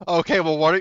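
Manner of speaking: usual speaking voice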